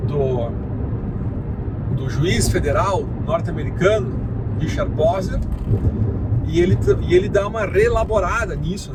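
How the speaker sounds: normal talking voice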